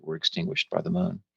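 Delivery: ordinary delivery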